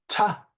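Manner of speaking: crisp standalone "Ta"; isolated T sound. Shown as a demonstration. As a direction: demonstrate "Ta" as the T ending sound. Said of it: "there are three sounds".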